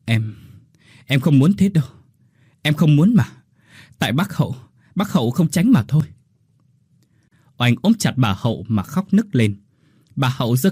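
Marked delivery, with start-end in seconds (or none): 0.0-6.1 higher, shaky tearful pleading; trembling voice. A narrator's reading. Oanh pleading through tears that she didn't mean it. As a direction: protest through tears, voice quivering. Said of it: "Cô run run".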